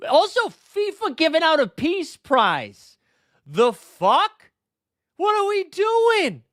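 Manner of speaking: high-pitched, incredulous outburst; exasperated disbelief. Real, not acted. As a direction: voice shoots way up in comic outrage. Ranting in disbelief at FIFA prize.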